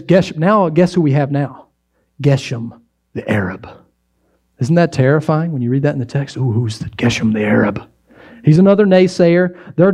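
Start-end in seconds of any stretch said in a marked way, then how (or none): 2.2-3.7 ominous reveal. Acted. Pastor dramatizes the scary new villain. 6.4-7.8 mock fright. Mock fear at Geshem's name.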